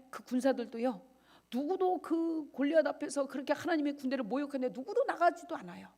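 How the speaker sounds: usual speaking voice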